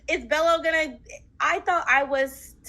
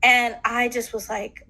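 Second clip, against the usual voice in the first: ordinary talk, nothing marked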